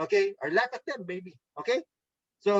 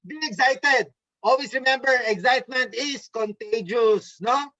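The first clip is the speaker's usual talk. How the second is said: excited; 3 semitones higher, 3 dB louder than usual. Shown as a demonstration. Trainer showing contagious excitement to the team, excited. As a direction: pump it up so the excitement catches. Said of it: "Just like this excitement".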